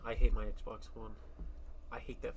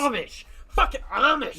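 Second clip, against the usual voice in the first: shouting; 12 semitones higher, 11 dB louder than usual. Real, not acted. Shouting insult.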